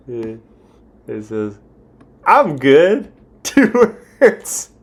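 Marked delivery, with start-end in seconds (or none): 2.2-3.1 high, loud, rising reply; gleefully incredulous echo; mock quote. Replaying the failed answer for laughs. 3.5-4.7 very high, loud, cracking up; incredulous, laughing exclamation; laughing disbelief. Real, not acted. Laughing at the two-word answer.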